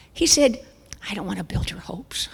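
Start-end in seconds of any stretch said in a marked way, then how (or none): 1.1-2.3 doctor's hushed whisper. Retold or imitated. Doctor whispering to her during the ultrasound. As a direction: whisper it as if no one else may hear.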